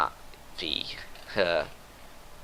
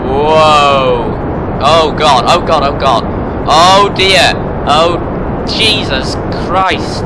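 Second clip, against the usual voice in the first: panicked yelling; 7 semitones higher, 14 dB louder, much slower than usual. Real, not acted. Rocket spinning out of control.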